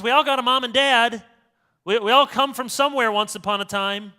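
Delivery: usual speaking voice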